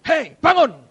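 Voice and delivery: God rousing a weeping believer, loud and firm; stern wake-up call. Acted. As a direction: snap him out of it. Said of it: "Ada kalanya dia berkata".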